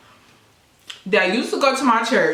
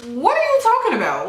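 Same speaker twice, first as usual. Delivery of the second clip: high-pitched, incredulous question; 11 semitones higher than usual. Retold or imitated. Girlfriend reacting to his sister's advice, high and incredulous. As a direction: act out disbelief, voice leaping up.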